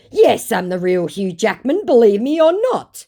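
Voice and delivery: hammed-up foreign scammer insisting; mock the scammer's clumsy English, insistent; broken English. Scammer insisting he is Hugh Jackman, hammed-up broken English. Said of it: "he says, finally falling a little in his English".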